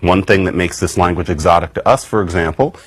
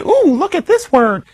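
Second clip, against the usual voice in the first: mock wonder. Mocking a listy approach.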